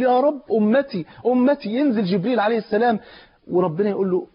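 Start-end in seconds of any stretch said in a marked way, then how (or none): 0.0-1.7 weeping plea. Acted. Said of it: "فقعد يبكي يقول".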